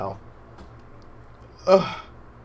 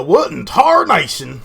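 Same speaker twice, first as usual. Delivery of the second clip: Southern exclamation; 5 semitones higher, 6 dB louder than usual. Shown as a demonstration. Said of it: "You go".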